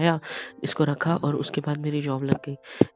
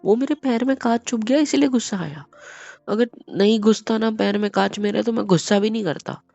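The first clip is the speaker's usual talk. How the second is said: louder, higher insistent explaining; heated explanation; 5 semitones higher, 6 dB louder than usual. Acted. Husband heatedly explaining the glass made him angry. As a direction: push the point hard.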